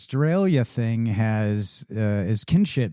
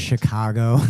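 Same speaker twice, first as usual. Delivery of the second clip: Midwest Chicago. Shown as a demonstration. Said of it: "lean into my Midwest accent".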